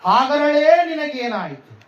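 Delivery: high, loud call, drawn out and falling; calling from heaven. Acted. Angel calling down to Hagar from heaven, loud drawn-out call. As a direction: call her name out from far above. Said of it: "ಆಕಾಶದಿಂದ ಆಗರಳನ್ನ ಕರೆದು".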